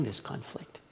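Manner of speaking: "this conflict" whispered, breathy and soft; whispered aside "this conflict". Real, not acted. Repeats the phrase as an aside.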